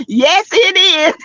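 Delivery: high, slow drawn-out yes; emphatic high agreement. Real, not acted. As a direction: stretch it out high. Agreeing with chat.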